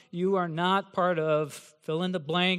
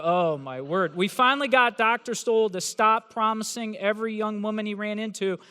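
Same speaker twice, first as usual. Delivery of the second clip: ordinary talk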